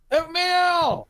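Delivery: high, loud holler of one word; excited yell. Acted. Gilbert charging downstairs to breakfast, excited yell. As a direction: belt it out like a battle cry. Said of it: "He goes".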